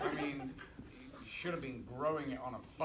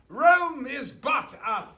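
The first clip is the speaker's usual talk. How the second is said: slow, booming, much higher stage declamation; grand theatrical recital; 10 semitones higher, 15 dB louder, slower than usual. Retold or imitated. Actor launching his speech on set, slow booming declamation. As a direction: declaim it like a Shakespearean lead. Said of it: "And he starts off".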